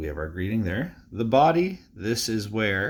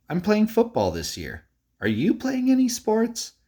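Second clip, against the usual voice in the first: questions rising high, exclamations louder, following every comma and mark; teacher modelling expressive reading of a friendly letter; expressive read-aloud; 4 semitones higher, 4 dB louder than usual. Shown as a demonstration. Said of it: "listen to how my voice goes up when I read".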